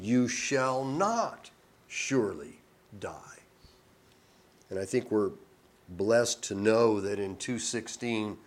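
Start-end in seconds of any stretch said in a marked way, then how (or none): 0.0-3.4 slow whispered lie, leaning on not; serpent's whisper. Acted. Preacher voicing the serpent tempting the woman, whispered. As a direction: breathe it like a sly snake's temptation. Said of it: "the serpent said unto the woman".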